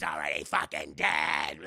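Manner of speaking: very low, slow demonic snarl; deep demon voice. Retold or imitated. Demon taunting.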